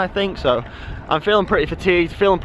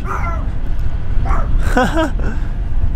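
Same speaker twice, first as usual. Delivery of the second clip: high breathy burst of laughter; 13 semitones higher, 4 dB louder than usual. Real, not acted. Laughs at own excuse.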